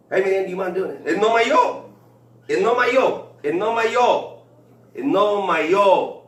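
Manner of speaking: put-on high, loud Mexican accent, repeated. Coworker on construction site correcting him, high and loud in an accent. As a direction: insist it like a coworker scolding. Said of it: "I picked up on the accent".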